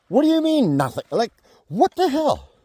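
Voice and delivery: shocked, indignant what the hell; outraged disbelief. Real, not acted. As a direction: throw it up in disbelief. Outraged at cops doing nothing.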